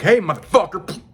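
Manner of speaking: high, loud "Hey, motherfucker!" snapped at a partner; mock yell. Telling off a froggy partner in bed with a shout. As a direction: bark it out to put them in place. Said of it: "you're like".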